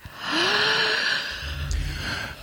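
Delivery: long, overwhelmed exhale; acted drawn-out sigh. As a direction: heave a big breath before answering. Mother asked what she wants.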